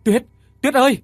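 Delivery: high, loud, pleading cries through a locked door; a man howling for his wife behind a locked door, desperate loud wail. Acted. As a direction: wail her name in despair. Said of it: "Khánh gào lên trong sự tuyệt vọng".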